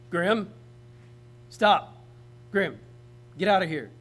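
loud, firm dad-like commands; dog voice. Shown as a demonstration. Owner telling his dog Grim to get out, stern dog voice. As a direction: order the dog off sharply and loudly. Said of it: "Your dog voice".

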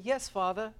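dutiful child in a put-on British accent. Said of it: "in a British accent say".

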